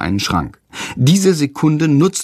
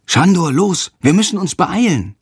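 higher, hurried urging; boy urging Shandor to hurry; urgent call; 7 semitones higher than usual. A narrator's reading. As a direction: hurry the bird along.

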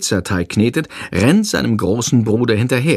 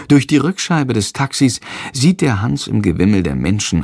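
usual speaking voice

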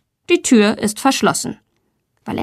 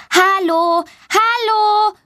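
put-on high child's call, not much louder; 11 semitones higher, slower than usual. A narrator's reading. Girl hears children and calls to them, high. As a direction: call after the passing voices. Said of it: "ruft sie laut".

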